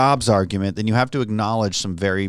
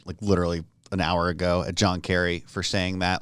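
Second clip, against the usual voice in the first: ordinary talk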